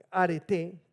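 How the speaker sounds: arete stressed on its final E; stress on the last syllable. Shown as a demonstration. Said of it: "con acento en la última". (arete con acento en la última sílaba, a-re-TÉ)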